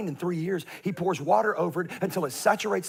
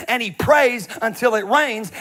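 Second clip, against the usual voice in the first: ordinary talk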